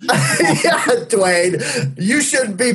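high breathy laugh through words. Real, not acted. Laughing at the Dwayne bit.

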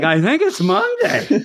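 put-on high, slow, punch-drunk voice; hammed-up dazed guess; dazed boxer. Host plays a man after a knockout, dazed. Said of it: "guy trying to get up from a Tyson knockout".

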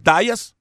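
"Díaz" as DYE-as; anglicized pronunciation. Shown as a demonstration. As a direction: say "Díaz" with an "eye" sound. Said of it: "él te dice que es".

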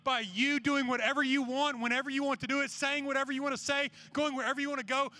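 driving, insistent altar-call plea; urgent, emphatic sermon appeal; impassioned preaching. Real, not acted. Urges a decision tonight.

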